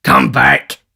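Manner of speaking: furious robber's order; calling back. A narrator's reading. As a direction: yell after a retreating confederate. Sikes calls Toby back.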